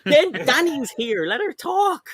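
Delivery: very high, drawn-out pleading; squeaky, exasperated appeal; exasperated high plea. Real, not acted. Comic plea to let guest speak.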